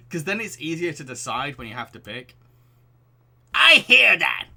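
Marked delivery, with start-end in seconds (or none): 3.5-4.5 high, loud booming agreement; loud exclamation. Acted. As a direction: shout it out in a put-on voice. Dad voice agreeing loudly.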